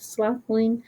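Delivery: plain reading voice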